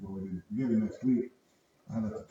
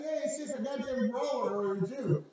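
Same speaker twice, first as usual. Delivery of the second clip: mocking retort; 13 semitones higher than usual. Mocking retold reply.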